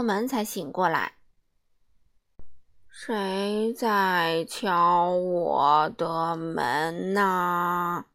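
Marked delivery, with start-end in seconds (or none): 2.9-8.1 very slow and low, dragging every word; a sleepy man who hates to hurry; lazy drawn-out Mr. Slow voice. Acted. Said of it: "一个缓慢的声音".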